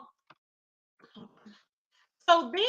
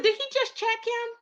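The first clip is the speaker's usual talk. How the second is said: high incredulous question; much higher, gasp it out; mock outrage; scandalised; 10 semitones higher than usual. Real, not acted. Gossip disbelief.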